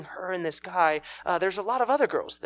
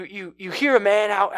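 normal talking voice

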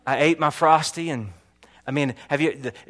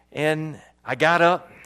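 ordinary talk

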